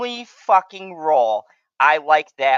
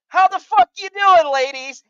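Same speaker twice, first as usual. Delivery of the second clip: much higher, loud mock shout; drunken buddy's hollered greeting; 8 dB louder than usual. Drunk Fernando hollering at the girls from a tabletop, loud and brash. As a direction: holler it like a drunk friend crashing the party.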